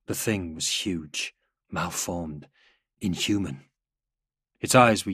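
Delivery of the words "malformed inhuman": ordinary delivery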